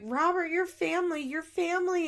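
high, sing-song gushing; gushing family voice. Retold or imitated. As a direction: coo it like a doting relative. Family gushing over Robert.

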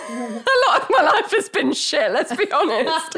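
high, laughing through the words; half-crying with laughter, high-pitched. Real, not acted. Admitting to the co-host how bad life has been, laughing. Darkly joking about own life.

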